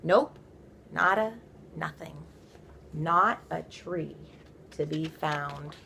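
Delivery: ordinary delivery, nothing marked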